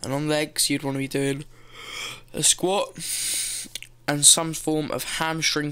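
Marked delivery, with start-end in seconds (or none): none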